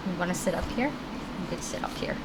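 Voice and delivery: soft whisper to the puppy. Real, not acted. Owner whispering to her puppy on her lap. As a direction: hush it to the dog.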